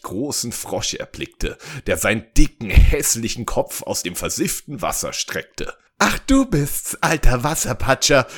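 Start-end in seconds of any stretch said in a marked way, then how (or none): 6.0-8.3 high, loud mock-weepy princess; hammed-up sobbing. A narrator's reading. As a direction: wail it like a storybook princess. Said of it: "schluchzte sie". (gespielt schluchzende Prinzessin, hoch)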